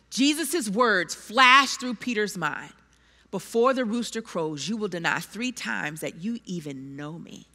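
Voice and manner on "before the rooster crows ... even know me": much lower and softer, like a voice remembered; hushed memory. Acted. Peter hearing Jesus' warning in his mind, hushed and low. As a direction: let it fade to a near hush as the words return.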